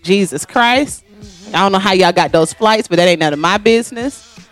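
ordinary talk

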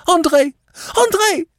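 very high, panicked scream of a name; frantic cry. A narrator's reading. Countess tries to stop André firing, frantic. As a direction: shriek his name in desperation.

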